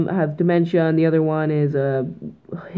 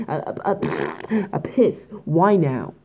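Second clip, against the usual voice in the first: usual speaking voice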